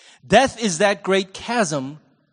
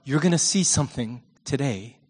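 tender reassurance in a hushed voice; gentle Jesus; 7 semitones lower than usual. Acted. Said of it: "And he's saying".

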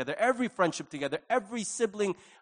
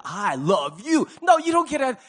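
building to a shout; 5 semitones higher, 8 dB louder than usual. Pretend. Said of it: "It's a way of saying".

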